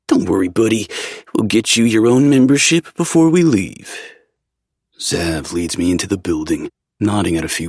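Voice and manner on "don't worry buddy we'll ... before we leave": put-on higher, cocky young man; playful friend voice. A narrator's reading. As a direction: tease him with a grin. Zev reassuring.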